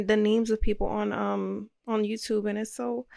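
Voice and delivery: ordinary talk, nothing marked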